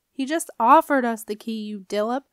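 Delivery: loud, cross comeback; exasperated retort. A narrator's reading. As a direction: snap back at a foolish friend. Septimus retorts in the quarrel.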